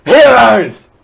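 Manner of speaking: big, high, booming mock yell; mock shout. Co-host echoing the tweet's all-caps word, mock shout. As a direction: bellow it for comic effect.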